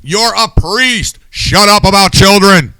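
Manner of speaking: loud, high, exasperated rant; fired up and yelling at the priest; irritated rant. Real, not acted. Angry host rants about a priest on air. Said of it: "I'm just irritated".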